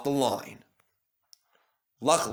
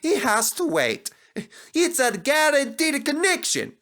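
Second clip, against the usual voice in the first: high indignant scolding; cross engine; 9 semitones higher, 3 dB louder than usual. Acted. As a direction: scold the diesel, exasperated. Engine complains about late train.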